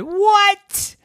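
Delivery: high, loud, shocked what; startled outrage; daddy shocked at giving the money back. Acted.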